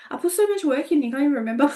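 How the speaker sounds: high-pitched playful protest; hammed-up reproach to the kids, breaking into laughter after; mock-indignant mum. Mock reproach to forgetful children.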